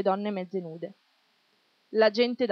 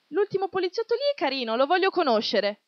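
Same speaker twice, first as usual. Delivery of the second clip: put-on tipsy girl; 8 semitones higher, 4 dB louder than usual. A narrator's reading. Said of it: "Una ragazza ubriaca disse".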